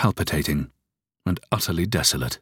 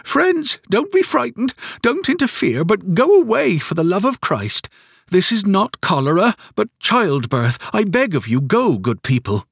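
much higher pleading cry; 13 semitones higher, 3 dB louder than usual. A narrator's reading. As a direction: plead with the people at the door.

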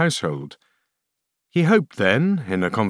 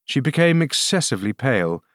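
usual speaking voice

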